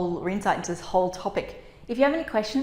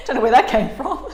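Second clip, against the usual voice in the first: laughing; 4 semitones higher, 8 dB louder than usual. Real, not acted. Laughing at own voice.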